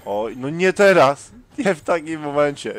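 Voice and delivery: high, loud wail of protest; dismayed outburst. Real, not acted. Player whose gamepad fails at the worst moment, dismayed outburst. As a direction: protest loudly at the terrible timing.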